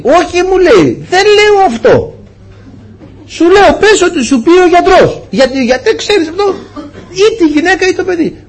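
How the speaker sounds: high, insistent voice of the stubborn man; insistent other man. Retold or imitated.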